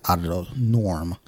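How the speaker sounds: usual speaking voice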